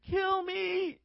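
high, held-out cry from across a room; imitated wailing plea. Imitates woman crying out in prayer.

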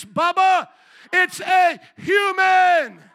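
high, booming shout, stretching the key words; preacher's shouted rebuke. Retold or imitated. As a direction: thunder it at a stubborn opponent. Retold rebuke, preaching at full force.

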